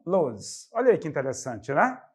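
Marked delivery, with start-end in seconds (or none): none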